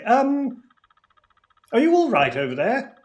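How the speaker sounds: calling out loud across the alley; shouting. Acted. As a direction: raise your voice to reach someone far off. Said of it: "I am just going to shout".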